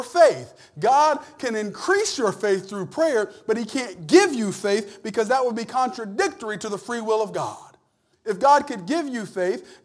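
loud, high, driving sermon voice; full-force preaching. Acted. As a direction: preach it hard to the back row.

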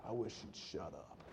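sigh, then very soft, low grumble; weary mutter. Acted. Annoyed listener beside a happy singer, weary muttering. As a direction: mutter it under your breath.

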